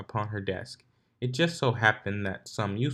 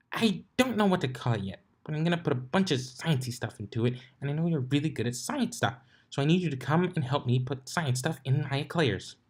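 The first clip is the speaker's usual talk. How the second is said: higher, eager pony voice; bubbly Pinkie; 5 semitones higher than usual. A narrator's reading. Pinkie begging Twilight to help with science eclairs. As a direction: plead it cheerfully.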